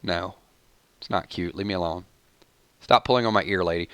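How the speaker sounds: low, soft deadpan protest; muted grumbling. Acted. Fending off a fawning lady, muted grumbling. Acted grumpy reply to fawning admirers.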